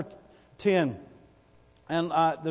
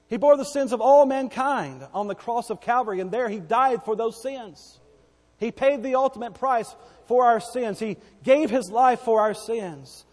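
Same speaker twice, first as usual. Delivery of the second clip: loud, high preaching shout; fervent preacher building to a shout; 5 semitones higher, 6 dB louder than usual. Acted. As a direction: cry it out from the pulpit.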